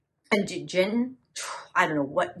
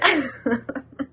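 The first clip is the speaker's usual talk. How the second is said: short high giggle; embarrassed laugh; 6 semitones higher than usual. Acted. Recalling a stupid lie to the roommate, embarrassed giggle. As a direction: giggle before confessing the excuse.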